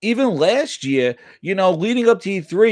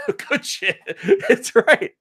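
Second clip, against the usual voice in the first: high, laughing through the words; cracking up at himself; 11 semitones higher, much slower than usual. Real, not acted. Laughing at his toilet story to the stream, high-pitched.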